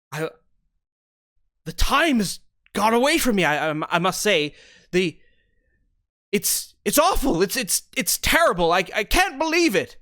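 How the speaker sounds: tripping over words in a breathless fluster; flustered villager bursting in with bad news; flustered panic. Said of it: "flustered and red in the face, panting".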